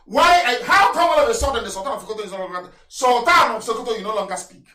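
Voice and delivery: fiery preacher calling out the silent; full-force preaching. Real, not acted. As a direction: hammer the question at the leaders.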